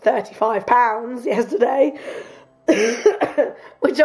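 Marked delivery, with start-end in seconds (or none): 2.7-3.5 coughing. Real, not acted. Sick speaker coughs mid-sentence.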